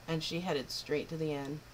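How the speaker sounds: ordinary delivery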